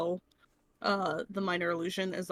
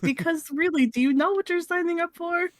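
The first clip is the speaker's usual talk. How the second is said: higher, louder hammed-up warning; mock cautionary speech; 8 semitones higher, 7 dB louder than usual. Joking warning to a child about the dark side, hammed-up plea. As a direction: plead in a put-on voice, rising as you go.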